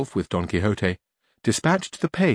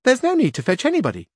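high, louder page voice; young page voice, raised and loud; 3 dB louder, faster than usual. A narrator's reading. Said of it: "said the page".

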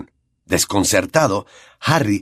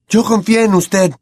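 much higher and louder, voice faltering; Harry shouting; 11 semitones higher, 4 dB louder than usual. A narrator's reading. Harry shouts at Lupin, much higher and louder, voice cracking. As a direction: yell it in betrayed anger. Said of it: "gritó a Lupin, flaqueándole la voz".

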